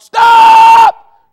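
very high, huge drawn-out scream; screamed warning. Acted. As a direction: scream it out like an inner alarm. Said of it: "your spirit's not screaming out".

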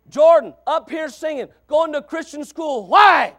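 high, loud build to a shouted question; preaching shout. Real, not acted. Preacher asking congregation about Jordan's change, preaching shout. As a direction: punch it out, peaking on the last word.

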